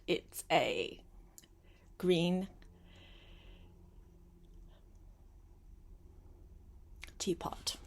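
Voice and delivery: trailing down to a breathy hush on teapot; hushed reveal. Acted. Storyteller teasing the child with a secret, hushed. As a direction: let the secret fade out.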